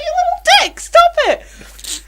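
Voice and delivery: very high, loud, laughing telling-off; laughing scold. Retold or imitated. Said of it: "I'm like".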